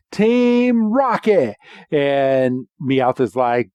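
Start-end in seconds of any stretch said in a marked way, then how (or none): 0.1-1.6 high, stretched-out team chant; Team Rocket. Retold or imitated. The team joins arms in line, high slow rallying cry. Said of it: "they're like".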